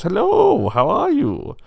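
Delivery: very high, loud, drawn-out hello; effusive rich pig. A narrator's reading. Well-to-do pig greets whoever knocked, effusive. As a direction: answer the door with a grand high-pitched flourish.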